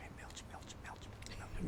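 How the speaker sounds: soft whispered call. Retold or imitated. Band members quietly whisper to get Milch's attention.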